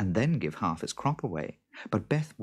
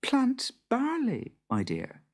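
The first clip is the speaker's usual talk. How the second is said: scheming wife; 11 semitones higher than usual. A narrator's reading. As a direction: reveal the trick with relish. Wife reveals her next trick.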